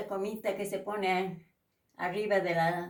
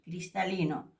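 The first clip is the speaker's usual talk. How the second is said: ordinary talk, nothing marked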